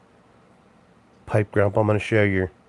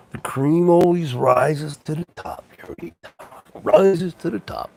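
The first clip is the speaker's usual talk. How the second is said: catchphrase; 5 semitones higher, 3 dB quieter than usual. Retold or imitated. Macho Man catchphrase.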